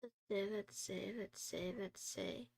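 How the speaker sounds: soft, trailing mutter under the breath; quiet murmur, fading; muttering to self. Real, not acted. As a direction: mumble while reading the screen. Muttering while searching.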